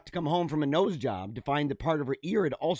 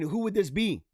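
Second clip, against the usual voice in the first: normal talking voice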